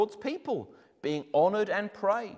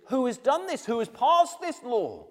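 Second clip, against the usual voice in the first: demanding king; 8 semitones higher, 5 dB louder than usual. Acted. King demands who did it.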